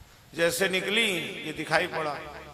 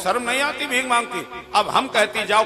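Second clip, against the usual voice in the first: high-pitched telling-off; haughty scolding; 5 semitones higher, 4 dB louder than usual. Acted. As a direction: assert authority while shooing the beggar. Mother-in-law herself now scolds beggar. (ऊँचे सुर में डाँटते हुए)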